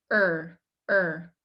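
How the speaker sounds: er with the tongue in the middle, lips open; American er. Shown as a demonstration. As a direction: say er with the teeth apart. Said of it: "there's a space between my teeth".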